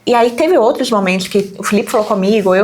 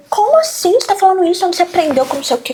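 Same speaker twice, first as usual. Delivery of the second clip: higher, alarmed questioning; retold shocked scolding; 8 semitones higher, faster than usual. Mother startled by her son's rude phrase. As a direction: ask the child in startled disbelief. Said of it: "Eu fiquei muito nervosa".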